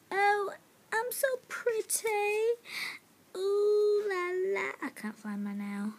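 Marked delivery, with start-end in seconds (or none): 0.1-4.7 higher, preening and pleased with herself; vain pretty voice. Pretend. Kid playing with nail polish, pretending, high preening voice. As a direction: admire yourself like a fancy lady.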